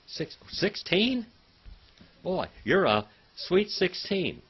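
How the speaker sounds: high, slow teasing lilt; playful teasing. Real, not acted. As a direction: tease her gently about being sixteen. Teasing a student about age.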